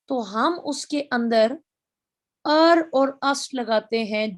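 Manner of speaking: ordinary talk, nothing marked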